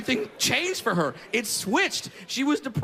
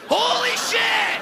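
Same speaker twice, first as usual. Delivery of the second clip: high, slow, loud holy shit; ecstatic exclaim; 14 semitones higher, 4 dB louder, much slower than usual. Acted. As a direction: burst out in pure joy. Joyous grocery moment.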